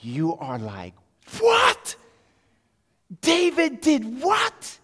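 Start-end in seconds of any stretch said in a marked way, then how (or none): 1.4-2.0 very high, loud outburst; astonished shout. Acted. Child hearing the sling hit, astonished shout. Child astounded at Goliath's fall. 3.2-4.8 high, slow, incredulous cry; astonished shout. Acted. Child hearing David felled the giant, incredulous shout. Child astounded at Goliath's fall.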